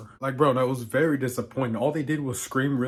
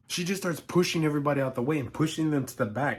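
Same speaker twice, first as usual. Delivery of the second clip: ordinary delivery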